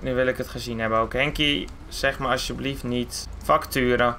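low, weary muttering voice; deep-voiced owner, fed up; exasperated car owner. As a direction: grumble to yourself in a deep voice. Car owner grumbles about Henkie.

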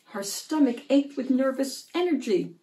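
ordinary delivery, nothing marked

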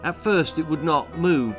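ordinary delivery

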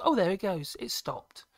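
usual speaking voice

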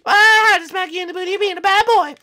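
very high, loud falsetto scolding; scolding store lady. Acted. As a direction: scold a naughty boy shrilly and fast. Store lady scolds boy she smacked.

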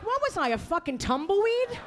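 high-pitched incredulous retort; exasperated. Retold or imitated. Daughter to her forgetful mother, exasperated. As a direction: demand it in mock exasperation.

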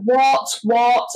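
anxious mum demanding. Retold or imitated. Mum in the car, sharply demanding what. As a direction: mimic a panicked mum pressing her. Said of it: "she was like".

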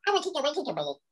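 high, fast squeaky squirrel chatter; chittering squirrel voice. Retold or imitated.

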